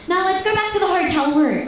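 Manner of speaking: high, urgent refusal; urgent insistence. Retold or imitated. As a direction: insist on leaving at once, pitched high. Urgently needs a bathroom, refuses walk.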